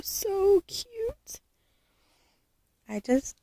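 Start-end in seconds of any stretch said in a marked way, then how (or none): 0.0-1.4 cooing. Real, not acted. Cooing over a doll.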